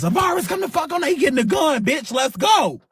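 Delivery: high, fast panicked yell to get out; urgent yell. Retold or imitated. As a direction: scream at your friends to run for the car. Yelling at friends to flee.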